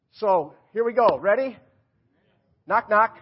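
loud, high call to the crowd. Real, not acted. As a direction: belt it out so the congregation answers. Cues congregation for a joke.

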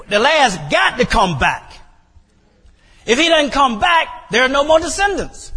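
rising to a high shout; faith declared. Acted. Abraham's faith voiced, shouting.

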